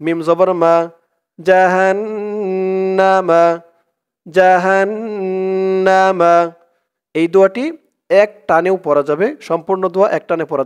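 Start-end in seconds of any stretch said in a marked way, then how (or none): 1.4-6.5 slow, sung-out জাহান্নামা with a held final a; melodic recitation. Shown as a demonstration. Teacher shows the unpaused reading, chanting. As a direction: chant জাহান্নামা long, flowing into the next word. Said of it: "না থামলে জাহান্নামা".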